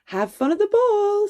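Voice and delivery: high sing-song farewell; godmother's cheerful send-off. A narrator's reading. Godmother sending Cinderella off, high and cheerful. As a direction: wave her off brightly. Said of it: "said her grandmother".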